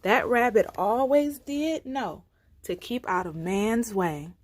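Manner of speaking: usual speaking voice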